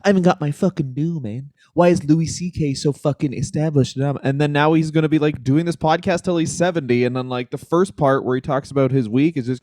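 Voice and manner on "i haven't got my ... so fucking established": grumbling jaded comic voice; lightly voiced washed-up comic ranting; bitter old comedian. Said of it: "bitter old comedian where he's like".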